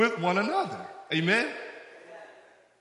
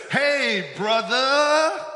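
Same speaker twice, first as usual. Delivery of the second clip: louder, higher hammed-up hey brother; mock street greeting; 5 semitones higher, 5 dB louder, much slower than usual.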